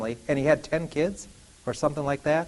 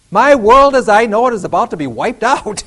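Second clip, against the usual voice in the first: dramatic Noah; 5 semitones higher, 9 dB louder than usual. Acted. Noah voiced.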